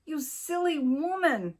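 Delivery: high exasperated scolding; exasperated cry. A narrator's reading. Husband scolding his wife, high exasperated cry. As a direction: scold her, fed up. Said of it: "he cried".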